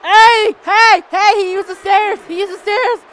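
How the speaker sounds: high, loud yelling; outraged calling out; indignant protest. Real, not acted. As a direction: shout to flag a foul. Protesting opponent's foul.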